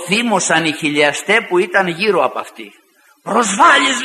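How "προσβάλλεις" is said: high-pitched mock protest; retold outcry. Offended believers protesting to the preacher about the girl, retold outcry. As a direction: raise the pitch as an offended objector. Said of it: "και φώναζαν στη διαπασόν".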